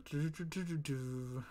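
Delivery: ordinary delivery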